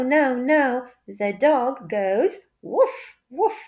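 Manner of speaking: ordinary delivery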